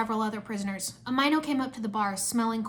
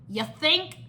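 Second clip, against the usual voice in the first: higher, rising sarcastic retort; sassy; 5 semitones higher than usual. A narrator's reading. Cole answers Zane's obvious remark, sassy. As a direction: toss it back with sass. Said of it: "Cole sassed".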